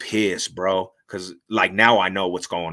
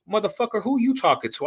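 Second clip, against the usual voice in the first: retold angry comeback; 11 semitones higher than usual.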